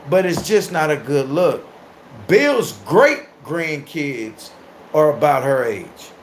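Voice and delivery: ordinary talk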